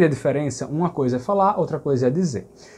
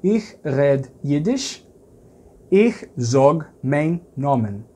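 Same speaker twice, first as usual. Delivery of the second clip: slow, every word set apart; teacher showing the Yiddish sentence slowly; slow Yiddish example; much slower than usual. Shown as a demonstration. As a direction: model the Yiddish phrase for learners. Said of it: "Você pode dizer em Yiddish".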